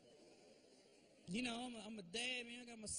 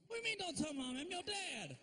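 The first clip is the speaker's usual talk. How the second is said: pitched way up in outrage; indignant dad; 9 semitones higher than usual. Retold or imitated. Dad outraged at kids hiding things from mom. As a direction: act offended, voice jumping up.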